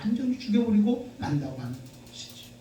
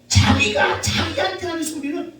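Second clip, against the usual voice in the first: fiery preaching; 11 semitones higher than usual. Acted. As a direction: drive the point home to the congregation. Preaching at full force.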